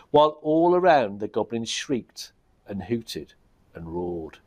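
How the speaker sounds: ordinary delivery, nothing marked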